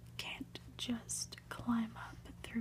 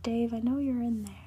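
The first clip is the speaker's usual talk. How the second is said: loud, quick, insistent call; 10 dB louder, faster than usual. Acted. Colleague coaxing Dave out of the booth, calling loudly. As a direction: call through a closed door, much louder.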